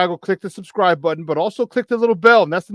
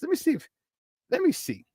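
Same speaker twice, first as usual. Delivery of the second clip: high playful voice; 10 semitones higher, 6 dB quieter than usual. Acted. Fiddling with playing a clip.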